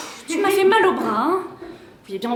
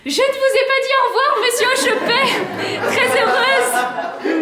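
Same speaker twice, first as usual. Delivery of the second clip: high, sugary society-lady goodbye; a high, simpering hostess voice; coy farewell; 8 semitones higher than usual. Acted. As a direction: flutter a sweet au revoir, stretching Très heureuse. Wife's coy parting to guest.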